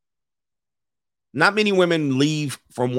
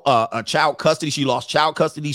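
ordinary delivery

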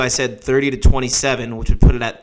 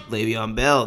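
ordinary talk, nothing marked

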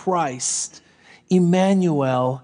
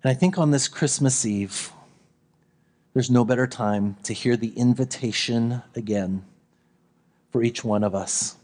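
gentle sermon close; 9 semitones lower, 5 dB quieter than usual. Acted. Pastor inviting the congregation on Christmas Eve, soft and lower. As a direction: invite them quietly to peace.

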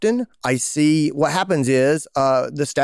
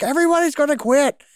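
high, louder mock panic; panicked; 3 dB louder than usual. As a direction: blurt it out in alarm. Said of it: "And you're like".

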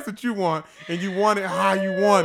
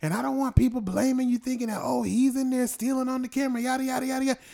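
higher mock voice of his wife lecturing; imitating wife; 8 semitones higher than usual. Husband parodying his wife's warning, higher mocking voice. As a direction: parody her earnest warning, pitched up. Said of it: "She goes".

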